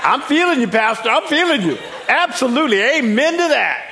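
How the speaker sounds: very high, loud, laughing agreement; imitated eager congregant. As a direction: whoop a hammed-up amen to the pastor. Acts out an eager congregation member.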